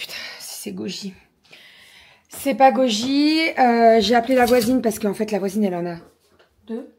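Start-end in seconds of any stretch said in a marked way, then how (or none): 0.0-1.1 hushed panicked whisper. Retold or imitated. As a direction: breathe it out in a whisper. Panicked thought voiced quietly.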